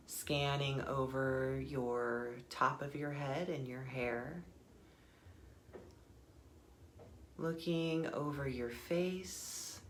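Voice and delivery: soft, unhurried meditation guide voice; guided meditation. Acted. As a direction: ease them inward with calm breath cues.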